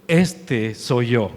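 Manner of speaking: deep, slow, solemn voice of God; a low, unhurried divine proclamation. Acted. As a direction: speak it as God declaring himself, low and slow. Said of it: "Dios básicamente está diciendo".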